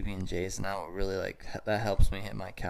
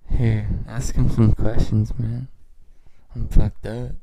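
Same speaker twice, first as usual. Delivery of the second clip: loud; 11 dB louder than usual. Real, not acted. Stoned friend yelling at the mic.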